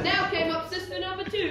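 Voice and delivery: plain reading voice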